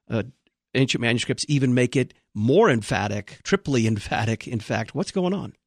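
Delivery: ordinary talk, nothing marked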